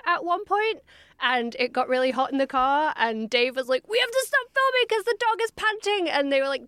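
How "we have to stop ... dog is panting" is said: put-on high voice, imitating an alarmed plea; high-pitched mock protest. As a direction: play up the concern in a squeaky-high register. Acts out actor halting the shoot.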